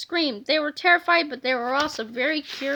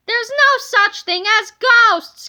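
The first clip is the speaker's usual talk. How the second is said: high, loud, slow insistent shout; yell; 7 semitones higher, 9 dB louder than usual. A narrator's reading. Kipper yells at his fleeing buddies, loud insistent shout. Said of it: "Kipper yelled".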